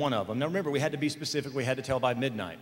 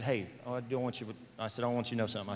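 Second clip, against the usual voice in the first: softer, retold aside to dad; retold confiding; 7 dB quieter, faster than usual. Son confiding to his dad at the table, softly. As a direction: drop the volume to confide something.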